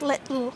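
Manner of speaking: careful little, T pressed on the roof of the mouth; mid-tongue T demo. Shown as a demonstration. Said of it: "while you're saying the T sound".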